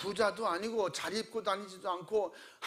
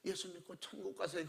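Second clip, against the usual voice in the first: mock whisper; 9 dB quieter than usual. Shown as a demonstration. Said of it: "귀에다 속삭 그랬겠어요".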